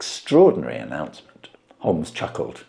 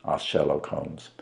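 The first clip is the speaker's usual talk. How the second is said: usual speaking voice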